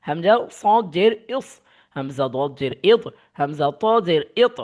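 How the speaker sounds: deliberate, singsong letter-by-letter drill; spelling drill recitation. Shown as a demonstration. Teacher drilling students on zer with jazm, spelling drill recitation. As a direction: recite each spelling, landing firmly on the joined syllable.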